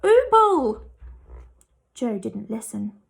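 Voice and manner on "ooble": higher, louder muffled cry; desperate cry. A narrator's reading. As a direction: cry out while choking down toffee. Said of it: "trying his best to swallow".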